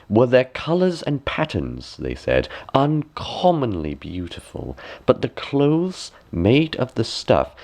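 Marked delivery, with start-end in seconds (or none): none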